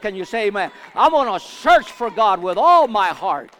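loud, ringing preacher declaring hunger for God; full-force preaching. Acted. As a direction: belt it out to the congregation like a revival.

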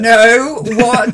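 loud, high, outraged disbelief; shocked cat. Acted. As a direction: voice an affronted cat recoiling in mock shock. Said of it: "And the cat was like".

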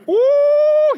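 high, loud gleeful squeal; shrill-free loud kid's whoop of glee; excited squeal. Retold or imitated. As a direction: squeal like a kid itching to fight. Son eager to fight.

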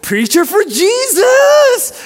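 loud, high, long-held cheer; Mark Anderson. Retold or imitated. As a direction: imitate a wildly joyful shout, stretching each word. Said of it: "and he's like".

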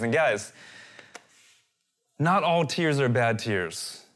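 normal talking voice

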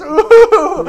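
high laughing ooh. Real, not acted. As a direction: laugh it out loud. Reacting with laughter.

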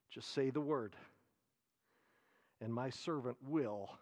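soft, humble request to a master; hushed humble plea. Acted. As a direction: say it quietly, as a soldier trusting his lord. Pastor voices centurion's humble request.

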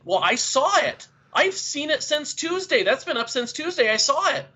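put-on high-pitched, indignant kids' protest; furious kids. Pupils protesting to their teacher about the found side quest, mock furious. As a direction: imitate outraged pupils complaining in a high voice. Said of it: "And they were furious".

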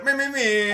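high, loud squeaky sound effect; imitated noises. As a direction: fire off high mouth noises. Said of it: "more of those noises".

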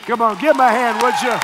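loud, high call to applaud; rally the crowd to clap. Real, not acted. Calling for applause.

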